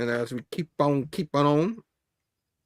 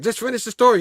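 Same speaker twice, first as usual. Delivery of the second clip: frustrated demand; 7 semitones higher, 5 dB louder, faster than usual. Acted. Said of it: "He got frustrated".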